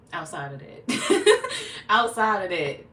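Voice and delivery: loud, slow, laughing through the words; real laughter. Real, not acted. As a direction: crack up while trying to move on. Laughing while dropping the topic.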